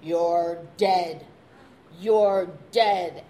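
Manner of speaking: loud, looming threat from the hands; menacing ghost voice. Retold or imitated. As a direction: boom it out like something unseen. Hands threaten him at night.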